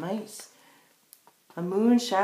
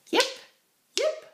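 very high yapping yips; fox yip. Shown as a demonstration. Teacher yipping high like the fox for children. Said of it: "And the fox says".

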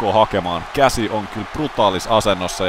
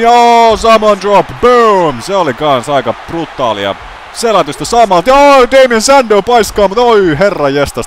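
much higher and louder excited call; excited shouting; 12 semitones higher, 10 dB louder than usual. Real, not acted. Commentator overwhelmed by rapid moves, high excited shouts. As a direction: shout every move as it hits.

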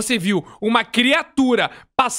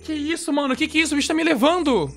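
higher, panicked shouts; 6 semitones higher than usual. Real, not acted. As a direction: panic as something grabs you. Being dragged by the monster.

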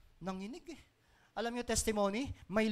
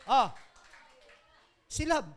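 ordinary talk

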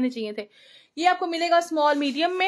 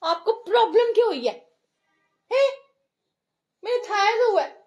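loud, high-pitched frustration; 6 semitones higher, 6 dB louder than usual. Real, not acted. Exasperated with fussing baby.

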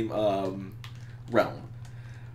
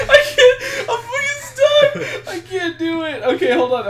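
much higher and louder, laughing through every word; helpless laughter; 10 dB louder than usual. Real, not acted. Stuck in the level, laughing too hard to play. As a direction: wheeze out the words while cracking up.

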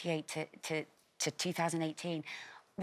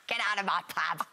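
Cockney accent. Shown as a demonstration. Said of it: "my Cockney accent".

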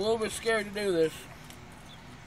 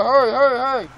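urgent; 3 semitones higher, 12 dB louder than usual. Real, not acted. Mouth burning, urging.

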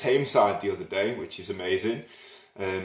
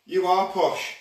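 high, loud mock posh English accent; demonstrating posh speech; 9 semitones higher, 5 dB louder, slower than usual. Shown as a demonstration. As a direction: put on a plummy posh voice. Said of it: "teach you to speak posh?".

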